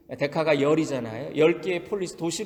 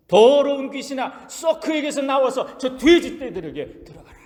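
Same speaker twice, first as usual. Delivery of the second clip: bold command; 9 semitones higher, 5 dB louder than usual. Acted. Jesus casting the unclean spirit into the pigs, a loud bold command. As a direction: drive the demon out with authority. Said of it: "과감하게 대답하십니다".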